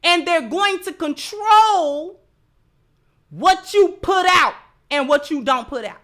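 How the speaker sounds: ordinary delivery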